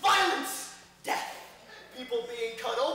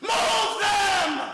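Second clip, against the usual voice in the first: shouting; 6 semitones higher, 5 dB louder than usual. Real, not acted. Calling out in a crowded theater.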